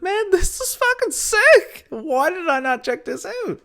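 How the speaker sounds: high, slow, gushing put-on voice; mock excited reaction. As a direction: squeal it like a thrilled fan. Imagined reaction to tutorial.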